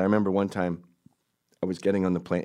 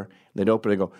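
ordinary talk

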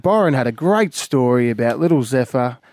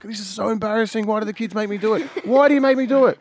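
embarrassed complaint; 8 semitones higher, faster than usual. Retold or imitated. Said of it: "and I'm like".

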